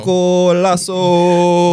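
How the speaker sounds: loud, high, stretched goal call; soccer commentator. Acted. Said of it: "commentator for soccer".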